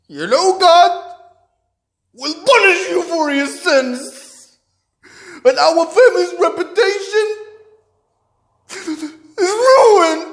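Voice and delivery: high, loud, drawn-out righteous fury; intense English. Retold or imitated. Father condemning his son for shaming the family, intense outrage. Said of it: "intense English".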